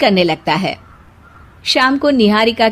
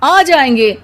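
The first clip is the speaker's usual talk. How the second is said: husband with raised pitch, exasperated; 3 semitones higher than usual. Acted. Husband calming his restless wife, exasperated.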